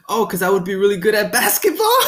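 high, loud, rushed quote collapsing into laughter; breaking into laughter. Retold or imitated. Retelling best bud's absurd answer, bursting into laughter. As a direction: crack up while quoting your buddy.

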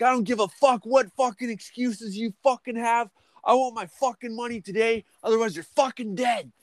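very high, strained threat; retold gunpoint demand. Gunman demanding his wages from the boss, very high strained. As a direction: shriek the demand like you're losing it.

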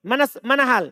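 ordinary talk, nothing marked

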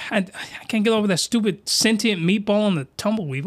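normal talking voice

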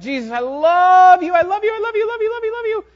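mock a worshipper swooning over Jesus; gushing worshipper. Worshipper in the prayer closet gushing to Jesus, mock swoon. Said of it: "and we're like".

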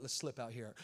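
hushed and low, creeping away; sneaky hush. Retold or imitated. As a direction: slip out without being heard. Sneaking out of restaurant.

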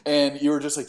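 ordinary talk

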